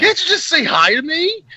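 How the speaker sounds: high, put-upon voice; a whiny, hammed-up complaint; mock hurt. Ranger to the gunwoman, mock hurt. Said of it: "Just irritated".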